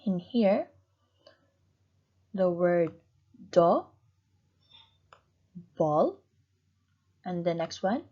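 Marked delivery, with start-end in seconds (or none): 3.5-6.2 "do, ball" as two beats with a long pause between; split into syllables. Shown as a demonstration. Teacher shows how double is cut, "do, ball" in two separate parts. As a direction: break "do, ball" apart, each part on its own. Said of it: "you cut them two times, two parts".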